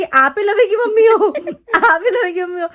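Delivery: laughing while retelling her own disbelief. Retold or imitated. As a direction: ask it while cracking up.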